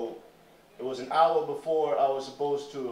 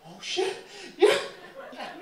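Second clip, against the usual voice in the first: put-on thrilled girl; 4 dB louder than usual. Girls thrilled to be in movie.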